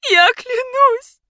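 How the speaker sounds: very high, loud, drawn-out cry; desperate shriek. A narrator's reading. Heroine begging during the countdown, desperate shriek. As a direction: scream the oath in terror.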